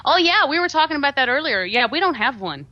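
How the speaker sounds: mock Canadian accent, starting high. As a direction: lightly imitate a Canadian client's lilt. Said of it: "He's like".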